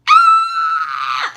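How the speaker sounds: very high, loud mock scream; bloody-murder scream. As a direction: shriek in hammed-up terror. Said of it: "screaming bloody murder".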